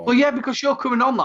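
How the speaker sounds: quick, high, eager tease; excited teasing. Real, not acted. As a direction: pounce on it with glee. Teasing guest about coming on.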